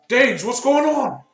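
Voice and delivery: guard voice. Acted. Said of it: "and he says".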